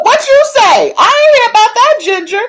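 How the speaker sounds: high, loud, fast gasp of what you say; shocked outcry. Real, not acted. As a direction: blurt it out in stunned disbelief. Shocked reaction to gossip.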